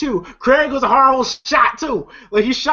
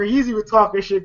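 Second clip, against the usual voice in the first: normal talking voice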